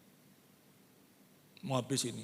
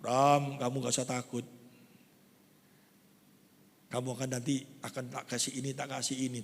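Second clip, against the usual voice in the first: softer, lower, calming voice; reassuring God; 8 semitones lower, 6 dB quieter than usual. Acted. God reassures Abraham, soft and lower. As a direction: gently tell him not to fear. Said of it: "Allah pada waktu itu berbicara".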